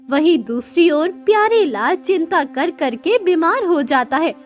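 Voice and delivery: ordinary delivery, nothing marked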